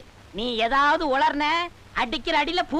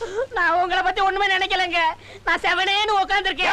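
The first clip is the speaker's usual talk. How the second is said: frightened protest; 6 semitones higher than usual. Acted. Disciple pleads with angry guru, panicky and high. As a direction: beg him to believe you, panicky.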